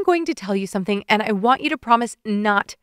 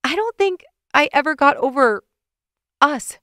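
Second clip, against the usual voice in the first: higher, louder, emotional rush; tearful confession; 5 semitones higher, 4 dB louder than usual. A narrator's reading. Woman confesses lingering feelings to Evan, high and tearful. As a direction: confess through near tears.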